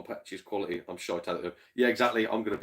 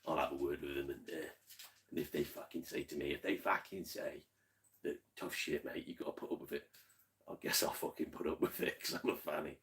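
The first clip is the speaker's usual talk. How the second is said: low, hushed mock-Cockney tough talk; 6 semitones lower, 9 dB quieter than usual. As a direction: keep the Cockney act down low and quiet. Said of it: "I've got me Cockney voice".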